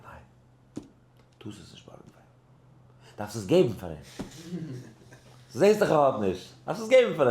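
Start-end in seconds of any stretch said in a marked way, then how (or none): 0.0-3.2 barely audible whisper, slow; hushed answer. Retold or imitated. Retold Rebbe answers lending question. 5.5-7.3 raised voice, high and loud; emphatic retold rebuke. Rebbe's line repeated with force.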